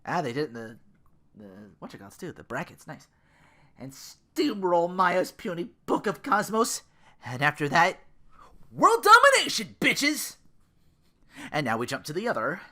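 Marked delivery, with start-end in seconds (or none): 3.8-10.4 rising to a loud high shout; villain boast. Acted. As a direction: gloat building to a triumphant cry. Villainous plan for domination.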